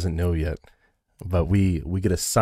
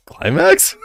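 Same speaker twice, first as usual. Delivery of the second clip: incredulous; 7 dB louder than usual. Retold or imitated. Mocking the misread word.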